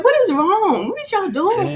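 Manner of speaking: high, baffled "what is wrong"; confused surprise. Retold or imitated. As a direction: play it totally bewildered as you walk in. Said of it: "I was like".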